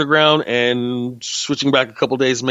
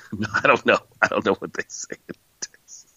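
usual speaking voice

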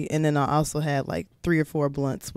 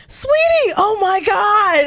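friend's mom; 6 dB louder, slower than usual. Retold or imitated. Friend's mom reacting, voiced in story.

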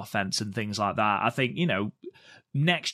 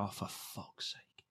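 breathy whispered groan of exasperation, slow; exasperated whisper; 4 semitones lower, 17 dB quieter, slower than usual. Retold or imitated. Maika fed up with her partner, slow exasperated whisper. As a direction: mutter it under your breath, fed up. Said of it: "you can literally see Maika's face go".

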